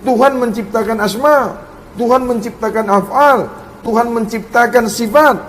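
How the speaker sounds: usual speaking voice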